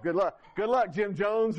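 high mocking sing-song. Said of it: "She's like".

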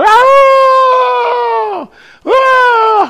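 high imitated scream, cries of a terrified girl; mock a frightened girl's high shrieks; retold scream. Distraught girl screams down the phone at night, high mock shriek. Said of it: "she's screaming to the top of her lungs".

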